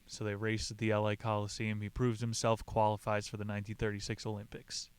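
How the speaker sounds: ordinary talk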